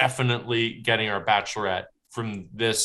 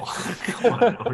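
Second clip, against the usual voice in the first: laughing protest; 9 semitones higher, slower than usual. Real, not acted. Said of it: "guys, chill".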